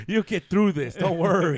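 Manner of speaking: mock comfort. Mock consoling about a married couple.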